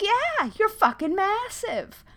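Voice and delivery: high mock disgust at a huge spider; mock disgusted self. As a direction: fake a squeaky horrified shoo. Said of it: "And I'm like".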